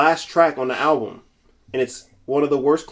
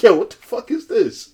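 tag outraged disbelief; 7 semitones higher than usual. Pretend. Voicing producer cutting the tape.

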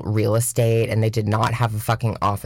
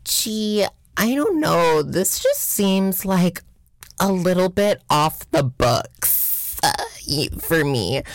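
high, slow, falsely naive voice; mock innocent high voice; 7 semitones higher, slower than usual. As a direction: play dumb in a high sweet voice. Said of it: "I was like".

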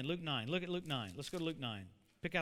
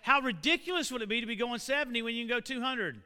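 loud, raised-pitch pulpit delivery; impassioned preaching; 4 semitones higher, 10 dB louder than usual. Real, not acted. Preacher urging the church not to waste its power, loud impassioned preaching. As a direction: preach it at full force, building louder.

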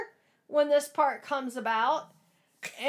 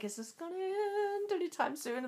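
inner thought; 2 semitones higher, 11 dB quieter than usual. Retold or imitated. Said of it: "I'm thinking to myself".

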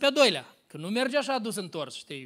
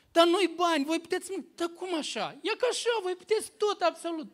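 eager reassurance; 7 semitones higher than usual. Retold or imitated. Reassuring girls they can eat.

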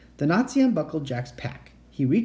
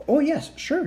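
fake friendly; 12 semitones higher than usual. A narrator's reading. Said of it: "faked a friendly smile".

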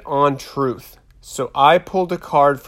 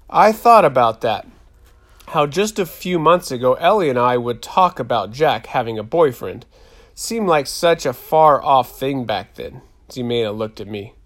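plain reading voice

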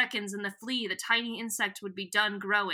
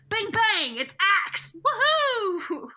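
much higher, louder whoops of glee; excited cheering; 10 semitones higher, 5 dB louder, slower than usual. Real, not acted. Friend thrilled at the Animorphs arriving, loud excited cheer. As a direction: cheer like the heroes just burst in.

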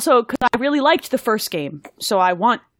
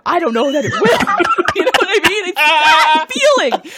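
laughing while talking, higher and louder; 10 semitones higher, 7 dB louder than usual. Real, not acted. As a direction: laugh through the words. Joking about being let down, laughing.

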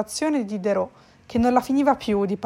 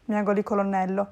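usual speaking voice

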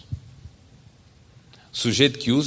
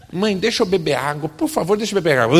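put-on higher, hurried little boy begging; pleading child; 7 semitones higher, 5 dB louder than usual. Son begging mother again, higher and hurried. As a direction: nag her one more time.

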